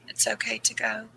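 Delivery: softly whispered, letting her go; whispered permission. Retold or imitated. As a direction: breathe it to her like a goodbye. Telling dying mother to let go.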